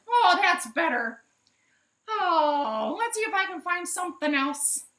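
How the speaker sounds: high, loud relieved chicken voice; relieved hen. Acted. Minerva tossing the hat off, high relieved hen. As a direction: breathe out relief in her hen voice.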